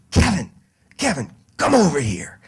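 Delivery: half-scream of a summoning mom; mock mom's scream. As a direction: call your son over like an annoyed mother. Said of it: "she screams".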